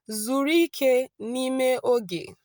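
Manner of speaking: slow, careful Igbo, vowels joined; slow Igbo demo. Shown as a demonstration. Teacher modelling Igbo for students, slow and clear. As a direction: model the phrase slowly for learners. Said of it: "relax in the moment in Igbo is".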